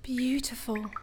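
soft, breathless whisper; hushed rapture; awed whisper. Acted. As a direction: barely breathe the word. Awed by Pierre's song.

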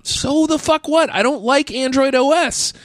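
high, fed-up comeback; exasperated outburst. Retold or imitated. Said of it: "I'm like".

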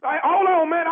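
loud. Acted. Caller panics as neighbor refuses.

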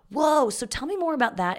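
high, loud fake wonder; mock fascinated. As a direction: gush to stall for time. Fakes interest to fill lesson.

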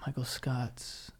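slow, unvoiced seductive whisper; mock sexy whisper. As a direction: breathe the names like a come-on. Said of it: "give me, like, something sexy real, like, right now".

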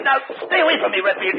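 high, alarmed cry to keep him back; panicked, pushing him away with the voice; scared high warning to stay away; frightened plea. Acted. Threatened man backs off.

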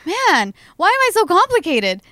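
acted-out exasperated outburst; exasperated self-questioning. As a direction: complain at yourself in frustration. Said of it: "And I'm like".

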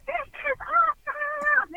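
very high, loud whoop, drawn out; imitated exultant scream. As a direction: yell it like a thrill-seeker in a storm. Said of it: "It was just like that".

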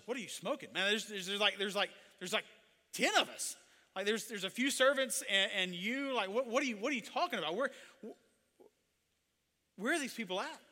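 flustered, high-pitched disbelief, stammering; bewildered, stumbling over repeated words; incredulous protest. Acted. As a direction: argue as the baffled servant. Bewildered servant disputes Elisha's count.

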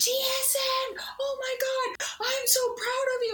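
shrieking high with joy; high thrilled squeal of pride; excited scream. Retold or imitated. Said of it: "And I screamed".

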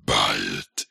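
low, whispered temptation; tempting whisper. Pretend. Tempting viewers to buy.

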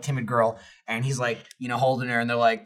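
ordinary talk

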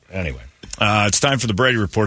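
usual speaking voice

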